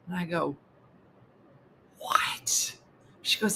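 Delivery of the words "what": whispered what. Retold or imitated. Narrator reacting to friend mid-session, whispered.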